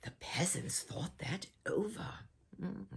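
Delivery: soft unvoiced whisper; secretive storyteller whisper; hushed whisper. A narrator's reading.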